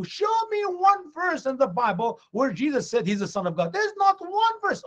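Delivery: high-pitched imitation of an insistent challenger; mock challenge. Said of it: "I know how Muslims always say".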